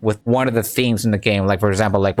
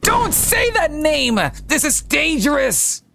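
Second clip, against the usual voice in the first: very high, slow paranoid warning; hammed-up crackpot cry; crazy conspiracy nut; slower than usual. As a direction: stretch it out in frantic high-pitched alarm. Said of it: "Tinfoil Head user ninety-five comes in and says".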